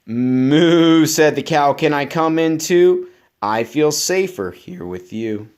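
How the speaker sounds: lively, bouncy storybook reading; reading to children. Acted. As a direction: read it up to keep a child listening. Reads picture book aloud.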